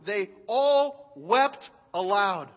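drawn out slowly, stress on all; slow emphasis. Shown as a demonstration. Preacher lingers over the people weeping aloud. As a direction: let the wailing sink in. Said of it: "This amazes me".

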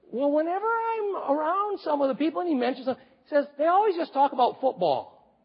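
put-on child voice. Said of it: "He said".

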